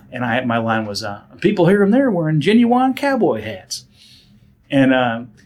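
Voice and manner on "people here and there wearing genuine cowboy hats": put-on thick Southern accent. Actor reciting his commercial line in a broad Southern accent. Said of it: "the most southernmost accent".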